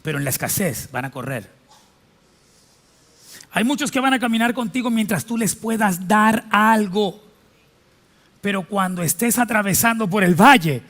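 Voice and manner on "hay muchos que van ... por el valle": full-force preaching. Acted. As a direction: proclaim it to the whole church.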